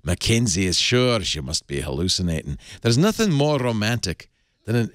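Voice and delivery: hammed-up Scottish romance narrator; Scottish accent. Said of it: "doing this in a Scottish accent".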